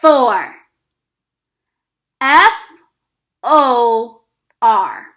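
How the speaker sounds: each letter called out loud and separate; teacher spells the sight word aloud for the class; spelling aloud. Shown as a demonstration. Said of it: "Let's spell them together".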